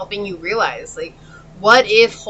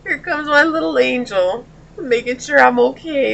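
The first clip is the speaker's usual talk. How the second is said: tearful, choking up, higher voice; crying; 5 semitones higher than usual. Real, not acted. Reader to her dog while crying through the reading, tearful. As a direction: speak through real tears. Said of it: "Every time I cry".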